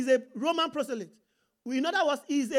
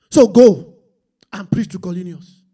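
booming command; 9 semitones lower, 13 dB louder than usual. Acted. God voiced commanding Peter.

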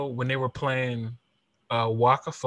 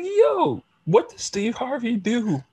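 high, slow incredulous yo; mock disbelief, drawn out; confused outrage at a meme; baffled; 10 semitones higher, slower than usual. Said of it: "And I'm like".

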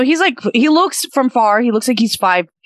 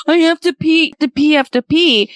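put-on little boys; 6 semitones higher than usual. Line of little boys voiced.

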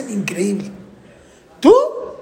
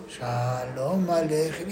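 slow, much softer, low and worn out; tired and bored; 7 semitones lower, 10 dB quieter, much slower than usual. Shown as a demonstration. Exhausted father mumbling the song at table. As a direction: drag it out like you can barely keep your eyes open. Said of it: "y estás cansadísimo".

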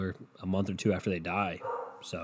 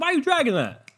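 put-on a small kid blurting rude things to mom; blunt curious toddler; 14 semitones higher than usual.

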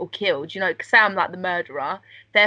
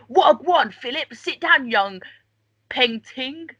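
higher, louder rough-spoken royal; rough Queen behind doors; 5 semitones higher, 3 dB louder, slower than usual. Retold or imitated. Queen scolding Philip behind closed doors, higher and louder. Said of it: "she's like".

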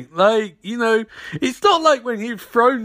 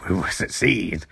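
much lower, slow mock sinister emperor; Palpatine voice; 14 semitones lower, 6 dB quieter, slower than usual. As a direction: intone it low and slow like a scheming villain. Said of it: "but in this movie he's like".

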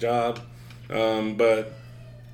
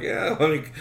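high, loud indignant complaint; mock protest; 8 semitones higher, 6 dB louder than usual. Son protesting to his mom, high mock indignation. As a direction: whine it up and out at her, aggrieved.

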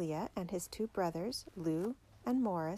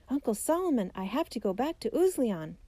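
raised, louder call with high pitch on the name; shouted; 4 semitones higher, 5 dB louder than usual. A narrator's reading. Boy rushing in to tell Uncle Solomon, shouted. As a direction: shout it out as urgent news. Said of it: "rushed inside and shouted".

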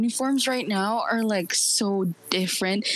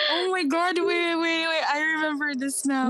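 amused disbelief; 7 semitones higher than usual. Real, not acted. Suddenly recalls the fight.

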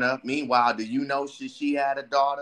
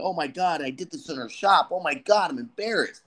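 mock panic, higher and flustered; panicky; 6 semitones higher than usual. Man embarrassed in the hair shop, mock panicky. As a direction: fret like you just said something awful. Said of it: "he's over here panicky".

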